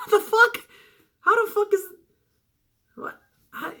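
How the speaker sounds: high, incredulous, breaking into laughter; laughing disbelief. Real, not acted. Host mocks a silly question to the Boogeyman, laughing in disbelief. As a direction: splutter it through laughter, too tickled to finish. Cracks up at own absurd example.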